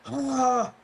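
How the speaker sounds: high, loud mock cry; imitated horrified cry. Acting out friend pulling down his pants, loud horrified cry. Injured friend sees the damage.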